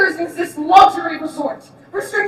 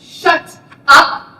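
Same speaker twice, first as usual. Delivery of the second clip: angry shout; 2 semitones higher, 9 dB louder than usual. Acted. Snapping at rambling cellmate.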